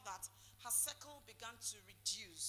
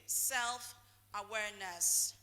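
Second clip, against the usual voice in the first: drawn-out stress; 4 semitones lower, 6 dB louder, much slower than usual. Shown as a demonstration. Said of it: "what did I say is number one".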